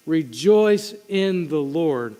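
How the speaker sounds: very slow, every word weighed; slow and deliberate. Shown as a demonstration. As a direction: lay out rejoice in the Lord slowly, word by word. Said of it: "He's going to continue to tell them".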